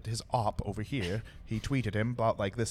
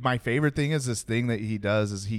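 normal talking voice

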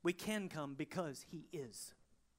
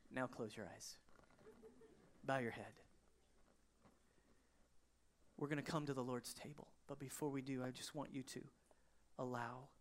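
low, soft, unhurried prayerful invitation; hushed altar call; 4 semitones lower, 5 dB quieter than usual. Real, not acted. Preacher asking congregation to bow heads, hushed prayerful invitation. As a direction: ease them gently into prayer, with pauses.

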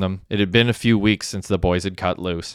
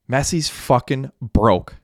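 mild yell; 4 semitones higher, slower than usual. A narrator's reading. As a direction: blurt it out slowly in a mild yell. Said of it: "yelled Hecky".

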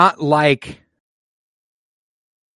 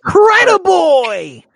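very high, loud, drawn-out cry; excited kid sidekick; 7 dB louder, slower than usual. Acted. Quoting the kid sidekick to a co-host, high excited shout. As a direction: shout it like an overexcited young hero.

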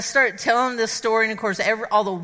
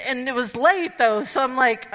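ordinary delivery

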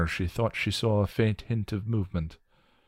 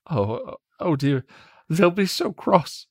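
put-on much higher, stammering scared young man; frightened William; 11 semitones higher, 3 dB louder than usual. William begs Willow to leave before they come, frightened and stammering. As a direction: plead nervously, tripping over the words. William panics at freed lock.

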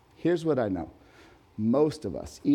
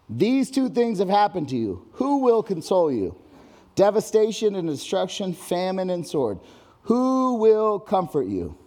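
loud, high-pitched proclamation of wrath; forceful scripture reading; 5 semitones higher, 5 dB louder than usual. Acted. Preacher to the congregation, reading God's wrath in a booming voice. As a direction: thunder God's warning over the room.

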